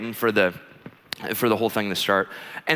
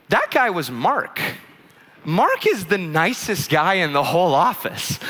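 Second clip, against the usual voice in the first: slow, higher-pitched emphatic correction; imitated indignation; 3 semitones higher, slower than usual. Wife defending kind coworker, imitated indignation. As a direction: spell it out for him, indignant.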